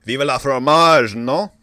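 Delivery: put-on French-London accent building to a loud cry; muddled French-London accent. Final words to the show's hosts, muddled French-London accent. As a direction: declaim it in a muddled French accent, swelling at the end. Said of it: "maybe work on the accent".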